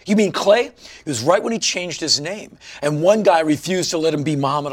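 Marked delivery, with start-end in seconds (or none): 0.0-0.7 higher, louder retold confrontation; mock angry challenge. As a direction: needle him like the angry guy on the bus. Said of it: "he goes".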